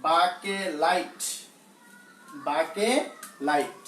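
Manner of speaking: বাকেলাইট drawn out slowly, split before লাইট; careful pronunciation. Shown as a demonstration. Teacher showing how an unfamiliar name is pronounced, careful pronunciation. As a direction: sound out বাকেলাইট in long, separate syllables. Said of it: "উচ্চারণটা একটু অন্যরকম".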